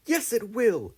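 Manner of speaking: high, loud defiant cry; defiant shout. A narrator's reading. Star defying the storm at the wheel, shouting. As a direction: refuse to give in to the storm. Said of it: "shouted Star and Stripe".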